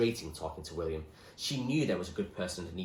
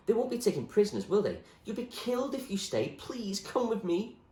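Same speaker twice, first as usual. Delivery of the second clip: put-on higher girl's voice, slowing to a plea; Zoe pleading; 13 semitones higher than usual. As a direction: beg him to come along.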